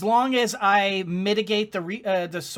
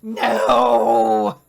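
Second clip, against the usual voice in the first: long, loud, tearful cry; despairing wail; 7 dB louder than usual. Real, not acted. Despairs at the sickness.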